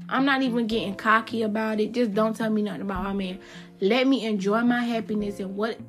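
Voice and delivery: ordinary talk, nothing marked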